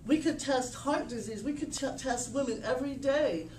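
ordinary talk

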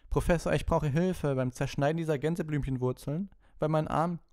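high, loud complaint to teacher; whining pupil. A narrator's reading. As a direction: plead for help in a high, put-on schoolboy voice. Malfoy complains to teacher about arm.

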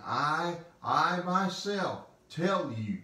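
slow, high, weighty "I myself"; emphatic stress. Acted. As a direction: drive home "I, I myself" slowly. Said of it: "it's emphatic".

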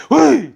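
sudden high, loud "으이!"; short acted-out exclamation; mock yelp. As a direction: let out a quick high-pitched cry. Acts out boring someone, noise.

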